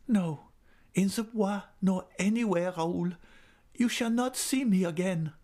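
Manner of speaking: put-on high, firm young woman; Christine voice. A narrator's reading. Christine refusing to meet Raoul again, high and firm. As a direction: refuse him gently but firmly.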